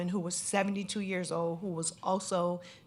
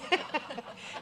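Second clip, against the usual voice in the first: laugh; 9 semitones higher than usual. Real, not acted. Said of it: "I have to make light of it".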